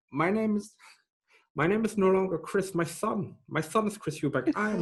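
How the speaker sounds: a touch of a formal boxer announcing himself; Chris Eubank impression. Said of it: "yours is identical".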